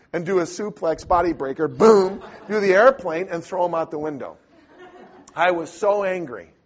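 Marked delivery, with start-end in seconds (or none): none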